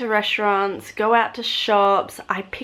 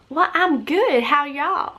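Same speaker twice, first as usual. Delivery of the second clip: much higher, put-on Southern US twang; copied Southern accent; 7 semitones higher, slower than usual. Traveller answering back in a copied Southern American accent.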